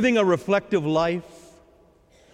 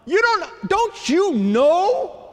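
much higher, slow, drawn-out protest; hammed-up incredulous complaint; exasperated disbelief; 10 semitones higher, 4 dB louder, much slower than usual. Disciples' exasperated reply imitated.

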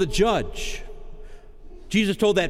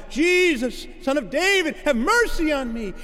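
crying out; 10 semitones higher than usual. Acted. Blind man by the road crying out to Jesus. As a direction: call out from the roadside. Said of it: "who cried out".

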